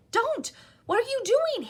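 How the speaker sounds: high urgent hiss. A narrator's reading. Harry stopping the elf, high and urgent. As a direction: stop him in a sharp, high hiss. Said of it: "Harry hissed".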